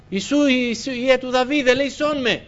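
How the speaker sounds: high, loud desperate cry for help; blind man crying out. Retold or imitated. Blind man shouting to Jesus for mercy, loud desperate plea. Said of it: "Φωνάζει".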